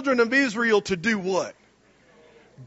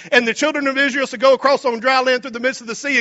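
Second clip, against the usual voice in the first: ordinary talk, nothing marked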